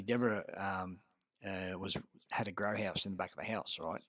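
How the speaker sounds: normal talking voice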